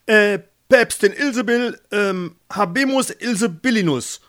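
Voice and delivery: high, loud herald's call with hesitations; loud proclamation. A narrator's reading. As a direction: proclaim it to the whole hall, fumbling the title. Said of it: "Der Page rief laut". (laut ausrufender Page, hohe Stimme)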